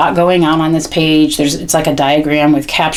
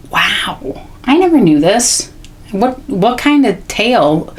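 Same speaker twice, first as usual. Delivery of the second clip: wondering, pitched up, stretching the question; demonstrated amazed reaction; 4 semitones higher, slower than usual. Shown as a demonstration. Parent reacting to shark book for child, amazed. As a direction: marvel aloud at a new fact for a child's benefit. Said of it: "I also can demonstrate a reaction".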